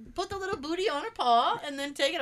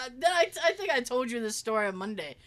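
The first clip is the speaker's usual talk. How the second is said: ordinary talk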